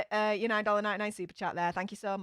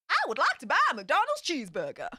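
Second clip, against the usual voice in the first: hammed-up Texan twang, higher and swooping; American accent; 4 semitones higher than usual. Shown as a demonstration. Streamer saying a viewer's requested line, mock American accent. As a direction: do a badly faked Southern American accent. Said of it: "[name]'s American accent that's not actually an American accent".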